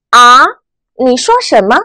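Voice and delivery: 啊 rising like a puzzled question. Shown as a demonstration. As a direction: ask 啊 with a rise, not catching it. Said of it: "声音往上扬". (啊，第二声，疑问语气)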